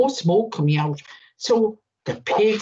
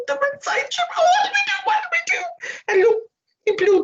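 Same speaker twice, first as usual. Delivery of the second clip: much higher, squealing little pig panic; panicking piglets; much faster than usual. Acted. Piglets panicking as the wolf blows their house down. Panicking pigs voiced high.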